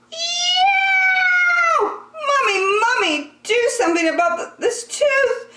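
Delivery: put-on high wailing child's cry, near tears; yelling child. A narrator's reading. Andrew wailing to his mom about the tooth. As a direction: wail like a kid with a sore tooth. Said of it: "and yelled".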